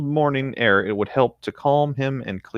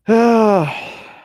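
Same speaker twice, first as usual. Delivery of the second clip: loud sigh; 12 semitones higher, 6 dB louder than usual. Real, not acted. Sighing after story ends.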